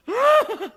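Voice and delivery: high, loud burst of fake bawling; hammed-up boyish wail; mock squalling. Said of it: "started squalling".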